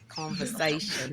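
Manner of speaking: put-on drawn-out comic London accent; mock London accent. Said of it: "done a London role play".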